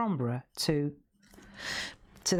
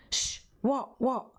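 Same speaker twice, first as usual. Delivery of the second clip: higher-pitched mock shush and question; shushing customer; 7 semitones higher, slower than usual. Customer answering her friend during a demo, shushing. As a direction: imitate hushing a pestering friend, pitched up. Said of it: "And I said".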